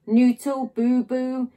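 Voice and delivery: ordinary delivery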